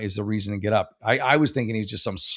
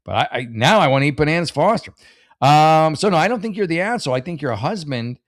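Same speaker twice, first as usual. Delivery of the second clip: ordinary talk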